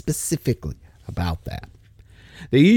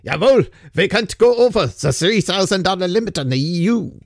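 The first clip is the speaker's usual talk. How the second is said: hammed-up mock German accent; bad German accent; 3 semitones higher than usual. A rule follower obeying the cash limit, in mock German accent. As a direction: do a badly faked German accent. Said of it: "not very good with my accents".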